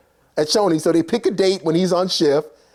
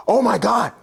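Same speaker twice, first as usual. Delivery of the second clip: mock-shocked gasp, then higher voice; appalled gasp; 4 semitones higher than usual. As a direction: act out an appalled onlooker. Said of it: "He's appalled".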